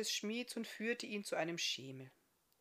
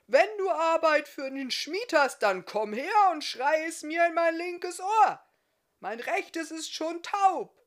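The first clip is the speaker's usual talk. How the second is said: much higher and louder, a bellowing shout; gruff smith shouting so he can hear himself; bellowing blacksmith; 10 semitones higher, 12 dB louder than usual. Acted. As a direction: roar it like a half-deaf smith across the forge. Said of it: "und brüllte".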